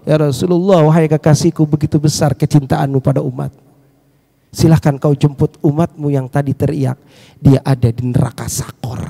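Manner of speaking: low, measured voice of God; divine pronouncement. Acted. Allah tells the Prophet to fetch his follower, low divine voice. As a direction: answer in a deep, composed register. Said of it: "Allah berfirman kepada Rasulullah".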